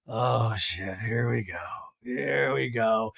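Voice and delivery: low, slow, dragging weary sigh of a tired guy; résigné et las, voix grave traînante; weary groan. Retold or imitated. Imagining weary Craig.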